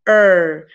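er said with the tongue pulled back; er as if the tongue were stuck; drawn-out English er; English R sound. Shown as a demonstration. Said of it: "Practiquen este sonido".

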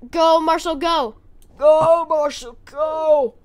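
loud, urging shouts; cheering on. Real, not acted. Player cheering Marshall on in a fight, loud urging. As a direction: yell encouragement at your champion.

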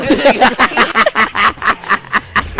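real laughter rolling on; shared laughter among casters; long laugh. Real, not acted. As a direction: laugh freely at the replay. Laughing at meme replay.

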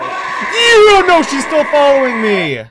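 high panicked shouting; panicking gamer. Real, not acted. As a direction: scream while being chased. Monster still chasing.

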